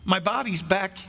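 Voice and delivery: high-pitched, urgent pleading; desperate insistence. Acted. Pleading to return to body.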